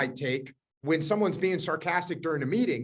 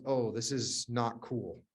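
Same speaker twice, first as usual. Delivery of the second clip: slow, low, softer imitated realization; sobered realization; 7 semitones lower, 8 dB quieter, slower than usual. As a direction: let it sink in slowly, low and subdued. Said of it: "she realizes".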